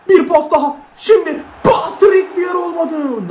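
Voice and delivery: high, stretched call; excited play call. Real, not acted. Gamer calling a pass as the attack fails, excited. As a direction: build the attack, stretching out the name.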